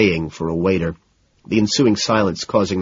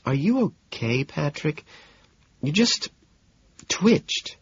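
higher, softened, halting concern; 7 semitones higher, 5 dB quieter, slower than usual. Acted. Woman asking her date if he is okay, genuinely concerned. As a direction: check on someone carefully, with worry. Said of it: "and then, genuinely concerned".

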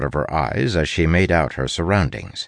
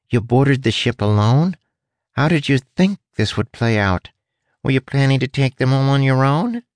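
pinched, higher-pitched rat questioning; 7 semitones higher than usual. A narrator's reading. Caged rat questioning a lone inspector's plan, pinched and higher. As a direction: squeeze out each question, pitched up. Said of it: "had a pinched quality to it".

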